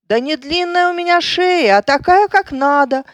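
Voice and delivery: high, indignant protest; indignant giraffe. A narrator's reading. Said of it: "возмутился жираф".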